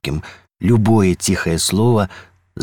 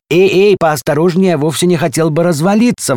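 high, loud grumbling protest; 9 semitones higher, 5 dB louder than usual. A narrator's reading. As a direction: complain in alarm, pitched well up. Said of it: "ворчал дом". (ворчливо, громко и высоко)

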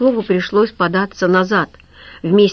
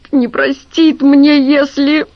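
high, louder tearful confession; breaking down crying; 6 semitones higher, 4 dB louder, slower than usual. A narrator's reading. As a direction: voice cracking into sobs. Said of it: "Лавровский плакал". (Лавровский плачет и признаётся, голос срывается вверх)

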